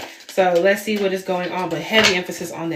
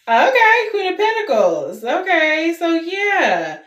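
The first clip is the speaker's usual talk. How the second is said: excited; 10 semitones higher, 4 dB louder, slower than usual. Real, not acted. Reacting to the drawn cards.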